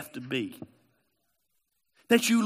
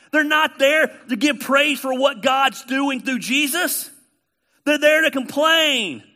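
loud, high fervent preaching; preaching at full force; 8 semitones higher, 6 dB louder than usual. Acted. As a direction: hammer it home from the pulpit.

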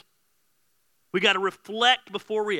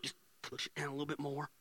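soft, much quieter aside; hushed self-talk; 12 dB quieter than usual. Pretend. Preacher acting out stuffing down anger as it rises, hushed. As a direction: quietly coax it back down.

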